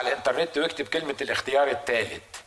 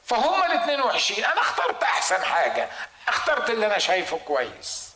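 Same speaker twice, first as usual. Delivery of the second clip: higher whiny excuse with laughing; preacher mocking a listener's excuse, higher, laughing; mock self-excuse; 5 semitones higher than usual. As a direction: mimic someone justifying themselves. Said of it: "وبعدين تقول".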